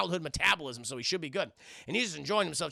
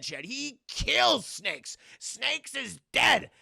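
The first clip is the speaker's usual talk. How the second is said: usual speaking voice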